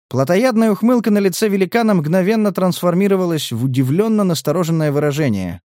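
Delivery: plain reading voice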